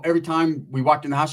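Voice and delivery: ordinary talk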